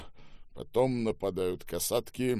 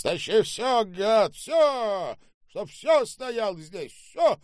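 high, loud yelling; raging orders; 14 semitones higher, 8 dB louder, slower than usual. Acted. Boss pounds the table ordering everything brought, raging. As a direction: hammer out the commands. Стучал кулаком Штаубе.

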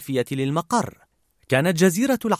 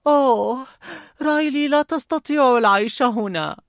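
high, woeful character voice; gloomy little lament; Sadness; 11 semitones higher, 4 dB louder than usual. A narrator's reading. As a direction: moan in a high, put-on sad voice. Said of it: "وأضافت حزن".

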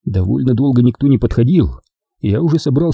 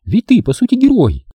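put-on higher young woman's voice, teasing; Дженни; 10 semitones higher than usual. A narrator's reading. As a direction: play the girlfriend, sweet and teasing. Jenny voiced by narrator. (нежная игривая Дженни)

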